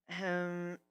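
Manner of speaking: slow, hesitant, unsure; nervous. A narrator's reading. Twilight uneasy at the griffin's long book list, nervously. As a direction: hold back, ill at ease. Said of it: "she said nervously".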